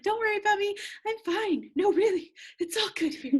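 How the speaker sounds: high, over-bright imitation of being okay; mock cheerful reassurance; fake-fine brush-off. Acts her brushing off her upset.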